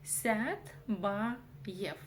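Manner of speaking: «Сятбаев» медленно, по слогам; покажи мягкое «ся» в «Сят-ба-ев»; звук «ә» через «я». Shown as a demonstration. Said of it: "за основу нужно взять букву". («Сятбаев» раздельно, с отчётливым «я»)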